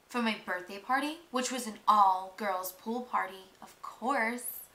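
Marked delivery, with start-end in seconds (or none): none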